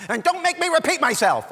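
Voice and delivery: loud, very high mock mom yell; yelling mother. Mother to her boys from the other end of the house, yelling. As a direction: holler it across the house. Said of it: "we could hear her yelling at us".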